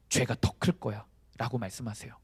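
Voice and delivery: ordinary talk, nothing marked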